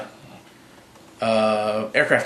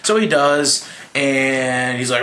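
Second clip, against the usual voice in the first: normal talking voice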